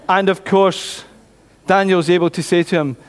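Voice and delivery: ordinary delivery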